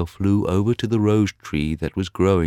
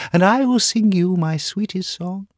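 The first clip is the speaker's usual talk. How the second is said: nightingale pleading; 5 dB louder than usual. Acted. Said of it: "she cried".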